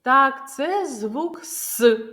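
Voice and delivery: slow, ending on "С" as a separate hissed s; isolated consonant sound. Shown as a demonstration. Teacher gives the last sound of the word, "С" said by itself. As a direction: sound out "С" alone, not as a letter name. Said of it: "який останній звук у цьому слові".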